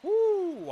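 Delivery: high, loud joyful whoop; excited whoop. Real, not acted. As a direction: let out a thrilled whoop.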